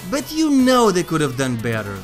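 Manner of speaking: usual speaking voice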